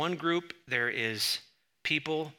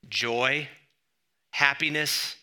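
ordinary talk, nothing marked

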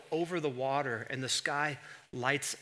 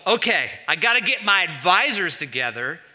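higher, louder hammed-up boss calling his advisors; mock boardroom God; 7 semitones higher, 11 dB louder than usual. Preacher mocking God as a CEO with advisors, loud and hammed-up. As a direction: play God as a manager running a meeting. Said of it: "He doesn't go".

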